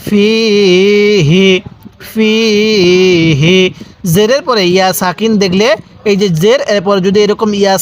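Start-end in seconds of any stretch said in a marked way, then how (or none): 0.1-1.5 "ফি" with a long ee, then short "হি"; one-alif madd. Shown as a demonstration. Teacher shows the ya-sakin rule, stretched "ফি" then short "হি". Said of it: "এক আলিফ টান দিব". 2.1-3.6 "ফি" with a long ee, then short "হি"; one-alif madd. Shown as a demonstration. Teacher shows the ya-sakin rule, stretched "ফি" then short "হি". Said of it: "এক আলিফ টান দিব".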